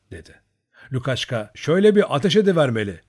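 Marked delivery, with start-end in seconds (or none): 1.5-3.0 raised, quick, louder taunt; mocking. A narrator's reading. Cossack mockingly suggesting firing a shot, mocking. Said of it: "dedi alayla".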